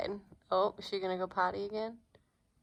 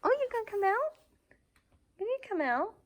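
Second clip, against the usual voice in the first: dog voice; 13 semitones higher, much faster than usual. Real, not acted. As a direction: coax the puppy out, up high. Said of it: "my dog voice".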